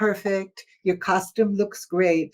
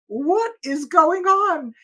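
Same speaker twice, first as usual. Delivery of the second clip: slow, higher, baffled question to herself; puzzled wondering; 8 semitones higher, slower than usual. Retold or imitated. Alone at an empty party, slowly puzzled. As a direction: wonder aloud what is happening. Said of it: "And I'm wondering".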